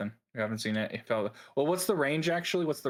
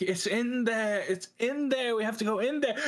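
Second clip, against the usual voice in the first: high, pleading in-character voice; worked-up, high-pitched urging; urgent insistence; 8 semitones higher than usual. Acted. Player voices insistent Lyra.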